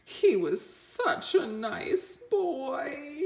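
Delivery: a hint of mourning villagers; weeping. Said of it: "weeping their sad eyes".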